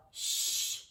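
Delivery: hushed, drawn-out shush. A narrator's reading. Classmate shushes Junie B, hushed. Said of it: "said a girl named May".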